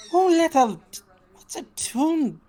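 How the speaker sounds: high-pitched, affronted exclamation; acted high outburst of surprise; indignant surprise. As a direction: exclaim at an unwelcome intruder. Chase exclaims at the stranger.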